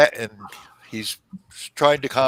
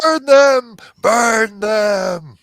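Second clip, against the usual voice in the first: loud, high crowd yell; mob shout; 10 semitones higher, 8 dB louder, much slower than usual. Acted. Crowd answers the knight's question, shouting for burning. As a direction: roar it like an angry mob.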